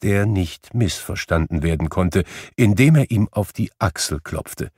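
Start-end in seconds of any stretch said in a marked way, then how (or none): none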